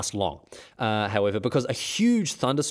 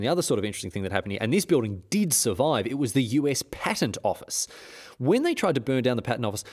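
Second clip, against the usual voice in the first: usual speaking voice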